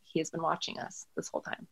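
breathy hushed realisation; fearful whispered thought; hushed inner thought. Retold or imitated. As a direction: whisper it to yourself in dread. Inner realisation while attacked.

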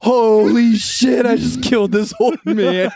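high, loud, slow Holy shit, half laughing; panicked disbelief, hammed up; mock horrified cry. After knocking the old man flying, mock panic. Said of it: "I was like".

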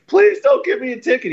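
high, pleading voice; mock begging attorney. Attorney begs the cop not to ticket him, high pleading. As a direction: beg like a caught man.

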